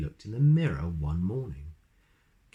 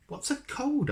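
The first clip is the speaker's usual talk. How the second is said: high curious question. Acted. Curious character asks wizard.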